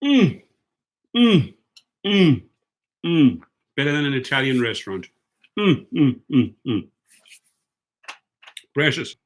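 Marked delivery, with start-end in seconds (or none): 0.0-6.9 savoring hums. Real, not acted. Vlogger tasting the pasta his daughter made, humming with delight. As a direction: hum like the first bite is heaven.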